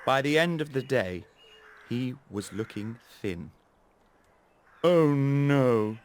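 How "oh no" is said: scarecrow voice. Acted. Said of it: "he said".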